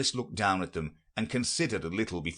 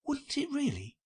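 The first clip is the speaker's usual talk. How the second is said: much higher, astonished question; great surprise. A narrator's reading. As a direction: eyebrows up, truly amazed. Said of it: "in a tone of great surprise".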